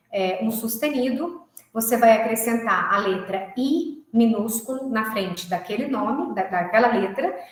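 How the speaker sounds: usual speaking voice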